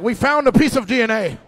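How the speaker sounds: loud mock cop excitement; mock investigators. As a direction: play eager detectives. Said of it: "They're like".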